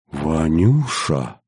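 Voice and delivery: slow, drawn-out startled cry of his name; grandmother's shocked slow gasp. A narrator's reading. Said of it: "ахнула бабка".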